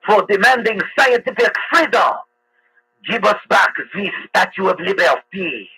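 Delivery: hammed-up movie French accent; mock French accent. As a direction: read it as a pompous movie Frenchman, French accent laid on thick. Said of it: "I just do the accent like in movies".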